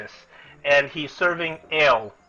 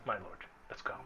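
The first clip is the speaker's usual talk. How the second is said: much softer, nervous servant; 6 semitones lower, 19 dB quieter, much faster than usual. Acted. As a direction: urge him away under the breath. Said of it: "looks at you nervously and says".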